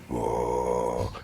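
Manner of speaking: very low, soft, sustained drone; long low "whoa", held on one note; imitated rising energy. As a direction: imitate a deep rumble welling up from below. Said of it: "all of a sudden I hear".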